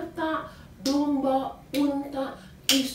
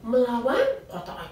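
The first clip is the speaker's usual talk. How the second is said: ordinary delivery, nothing marked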